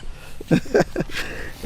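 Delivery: airy high-pitched chuckle; a smug little breathy laugh; breathy giggle. Acted. Flirting rich man laughs.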